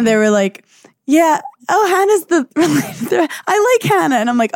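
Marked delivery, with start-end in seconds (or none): 1.1-4.2 high, sing-song gushing fan; mock the fans sweetly, pitched up. Mimics fans' gushing voices.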